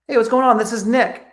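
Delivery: bright, high, friendly hello; upbeat greeting. Shown as a demonstration. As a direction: greet him like an old friend. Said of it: "So what do I say?".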